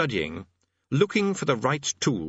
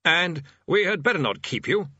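higher, courteous dismissal; polite; 7 semitones higher than usual. A narrator's reading. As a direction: politely excuse yourself. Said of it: "the bursar added politely".

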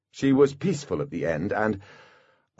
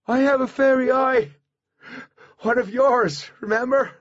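high, strained calling voice; desperate raised voice; trapped Artemis, urgent; 4 dB louder than usual. A narrator's reading. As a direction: explain urgently through a barrier.

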